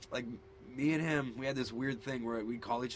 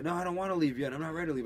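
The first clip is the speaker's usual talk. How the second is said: put-on tired kid refusing to go, higher and louder; imitated kid digging his heels in; child's protest; 5 semitones higher, 5 dB louder, faster than usual. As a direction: protest like a fussy little boy. Retelling tired son's protest.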